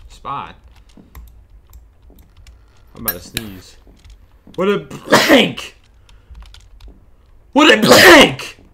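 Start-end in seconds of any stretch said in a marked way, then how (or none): 4.6-5.8 slow, high and loud cry; hammed-up excitement. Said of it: "really passionate about wooden planks". 7.5-8.6 even higher, louder slow cry; over-the-top excitement. Acted. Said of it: "really passionate about wooden planks".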